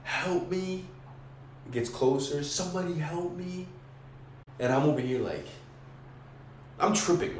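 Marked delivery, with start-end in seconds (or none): 0.0-0.8 imitated plea. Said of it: "someone's screaming". 2.4-3.7 imitated plea. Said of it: "someone's screaming".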